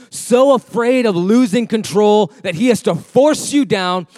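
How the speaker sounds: loud, high, driving sermon; fiery preaching. Acted. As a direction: preach it hard to the crowd. Preaching at full force.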